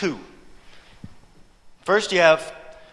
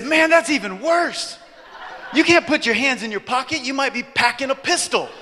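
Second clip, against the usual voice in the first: exasperated stranger; 7 semitones higher than usual. Retold or imitated. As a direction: play the stranger's incredulous outcry, pitched up. Acting stranger alarmed at pocketed hands.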